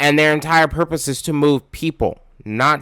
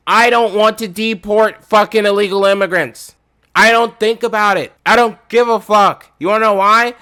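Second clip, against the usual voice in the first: angry rant; 6 semitones higher, 4 dB louder than usual. Real, not acted. Ranting, raising his voice.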